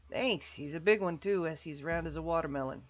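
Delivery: boisterous voiced game character; jovial character voice. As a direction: greet an old pal with big cheer. Anatoly telling his joke.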